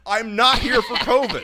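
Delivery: high, loud, slow protest; emphatic repeat. Retold or imitated. Patient corrects the check-in worker, loud and emphatic.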